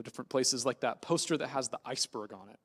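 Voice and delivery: ordinary talk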